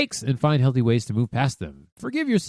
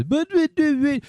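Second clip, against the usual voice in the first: high, squeaky "But, but" much higher; whiny childish but-but; mock whining excuses; 13 semitones higher than usual. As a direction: mimic a whiny excuse-maker. Mocking excuses.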